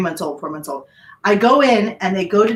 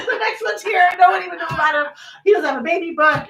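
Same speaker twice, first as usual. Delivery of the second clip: higher, giggling through it; laughing mock; 7 semitones higher than usual. Mom joking about her second baby, laughing.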